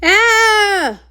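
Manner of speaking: a sharp high scream of alarm; terrified scream. Acted. Pony screams as the swamp attacks, high alarmed cry.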